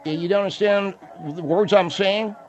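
high mumble through a misshapen mouth; mumbling. Acted. Auxiliary policeman challenging a caller who can't understand, mumbling high. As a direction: question her as if your cheeks are squeezed in.

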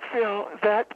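ordinary delivery

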